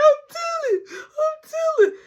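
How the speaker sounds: high, loud tattling wail; hammed-up sobbing squeal; mock crying. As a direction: bawl it like a tearful child. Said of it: "I'm talking about crying".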